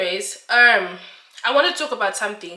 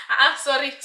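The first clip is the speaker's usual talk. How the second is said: sorry spoken through laughter; laughing apology; 5 semitones higher, 4 dB louder than usual. Real, not acted. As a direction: still laughing while apologising.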